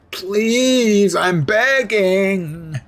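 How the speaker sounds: high, slow, whiny pleading; begging voice. Pretend. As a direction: drag out the begging, annoyingly. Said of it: "That's my begging voice".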